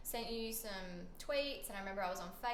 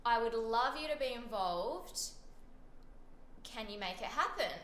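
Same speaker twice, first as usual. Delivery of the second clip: eager, louder retelling of a message; excited quote; 5 dB louder, slower than usual. Retold or imitated. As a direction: read it out with bubbly energy.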